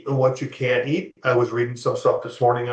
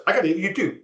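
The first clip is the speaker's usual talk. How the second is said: very fast, higher-pitched quote; rapid-fire imitation; 5 semitones higher, much faster than usual. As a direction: rattle it off like a hyper fast talker. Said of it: "he said it like this".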